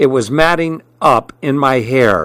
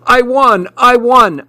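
high, loud victory cry; triumphant shout; 11 semitones higher, 3 dB louder than usual. A narrator's reading. Jack holding up the caught chicken, shouting in triumph. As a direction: crow your win over and over. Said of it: "I shouted".

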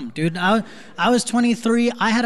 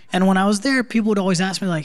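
ordinary talk

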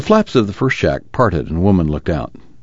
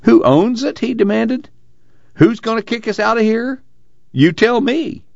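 high-pitched, confrontational challenge; narrated bearded man squaring up; menacing demand. A narrator's reading. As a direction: press the questions hard, voice pushed right up. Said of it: "The bearded man stepped forward menacingly".

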